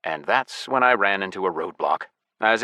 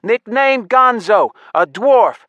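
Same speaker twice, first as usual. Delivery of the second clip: slow, high, loud announcement; shouted relay; 13 semitones higher, 9 dB louder, slower than usual. A narrator's reading. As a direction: shout the description out to every guard. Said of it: "shouted into the amulet again".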